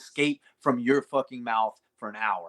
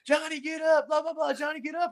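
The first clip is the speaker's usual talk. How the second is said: much higher, mock nagging mom voice. Mocking a mom yelling at her son from the side of the mat. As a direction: whine it up high like a nagging parent. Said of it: "she was like".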